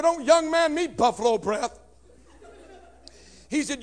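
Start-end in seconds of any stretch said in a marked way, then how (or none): 0.0-1.8 higher, louder snapping comeback; angry retort. Retold or imitated. Teen yells back at stepfather, angry. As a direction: fire the insult right back.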